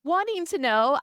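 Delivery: mother's wounded reaction to her daughter's accusation; hurt inner protest. Retold or imitated. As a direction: cry out inwardly at the injustice. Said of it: "And I'm like".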